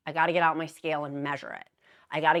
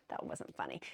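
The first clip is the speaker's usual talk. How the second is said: whispered aside; 15 dB quieter than usual. Acted. Whispered aside after joke.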